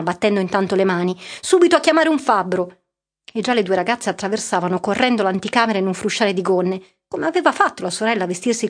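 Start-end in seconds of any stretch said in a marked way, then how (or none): 1.4-2.8 much higher, louder command; commanding father; urgent order. A narrator's reading. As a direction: bark the order at once. Father orders maid for locksmith.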